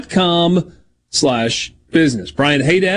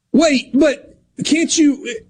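high, slow mock protest; stretched-out, pitched-up appeal; imitated helplessness; 12 semitones higher, much slower than usual. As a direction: act out a helpless, halting plea. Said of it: "if helplessness is the right word".

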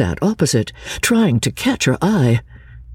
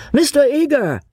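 high-pitched call for attention; 12 semitones higher than usual. A narrator's reading. Mister Beebe hailing Mister Eager from the carriages, calling out. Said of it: "called Mister Beebe".

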